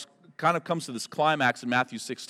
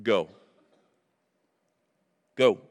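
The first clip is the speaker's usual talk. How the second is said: commanding Jesus; 6 semitones lower than usual. Acted. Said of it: "speak it".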